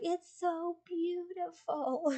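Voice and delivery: high, very slow, swooning voice; dreamy sleepy friend. Retold or imitated. Sleep-deprived friend admiring the cucumber photo, high and drawn out. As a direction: gush over it like it's a masterpiece, way up high. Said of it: "But she was like".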